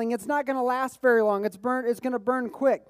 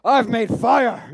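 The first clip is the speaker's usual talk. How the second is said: loud, chest-thumping castaway triumph; big slow boast of a man who made fire; hammed-up primal victory shout; Tom Hanks in Castaway; 9 dB louder, slower than usual. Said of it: "It's like Tom Hanks from Castaway".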